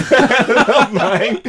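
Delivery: high, slow, laughing through the words; giggling mock denial. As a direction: replay the lie while cracking up. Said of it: "He's lying".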